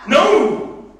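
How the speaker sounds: high loud shouted no; loud flat refusal; emphatic no. Real, not acted. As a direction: slam it down.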